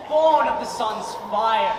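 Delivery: booming slow boast. Acted. East Wind boasts grandly.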